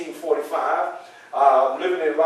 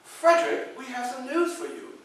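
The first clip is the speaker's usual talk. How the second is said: lady's call; 7 semitones higher, 4 dB quieter than usual. Retold or imitated. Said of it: "that's how they were speaking".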